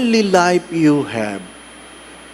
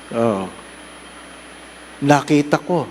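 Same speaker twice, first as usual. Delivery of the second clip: normal talking voice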